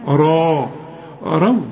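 را with a thick, dark r, then رو with light waw; heavy ra. Shown as a demonstration. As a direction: say را heavy, keep the waw of رو thin. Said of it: "الراء فخمها، الواو رققها". (را مفخمة والواو مرققة)

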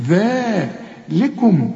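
very slow ذلكم with the ذا stretched out; tongue-tip ذ and a long alif in ذلكم; long vowel. Shown as a demonstration. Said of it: "ومدين الذال ألف كاملة".

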